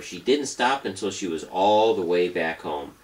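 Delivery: usual speaking voice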